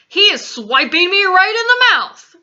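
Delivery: loud, high-pitched complaint; outraged yell. A narrator's reading. Giant woken by a smack, indignant yell. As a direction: yell out as if just hit. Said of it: "yelled the meat dripper".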